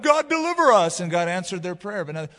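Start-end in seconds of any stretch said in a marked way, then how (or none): 0.0-1.0 high, loud plea to God; imitated cry for deliverance. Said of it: "They cried out".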